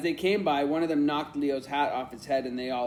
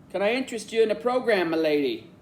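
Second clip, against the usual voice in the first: higher-pitched, put-on English accent; Oliver Twist; 5 semitones higher, 3 dB louder than usual. A narrator's reading. Leo pitching a program to an older lady, English accent. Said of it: "sounding like Oliver Twist".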